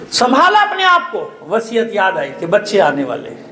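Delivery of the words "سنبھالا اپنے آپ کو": wailing cry. Acted. Climax of lament.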